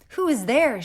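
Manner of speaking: loud, high call through the door; rosebud calling out. Acted. Rosebud calls to the tapping at her door, loud and high. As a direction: call out who's knocking.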